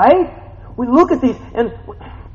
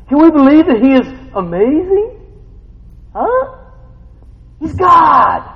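loud, rising preacher's call; full-force preaching; 7 semitones higher, 8 dB louder than usual. Acted. Preacher challenging the congregation, loud and rising. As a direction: drive each question home to the church.

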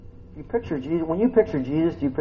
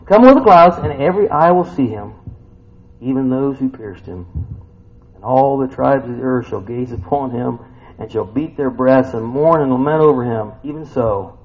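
preaching scripture aloud; 8 dB louder than usual. Acted. Preacher reading scripture to his congregation, loud and proclaiming. Reads scripture with rising force.

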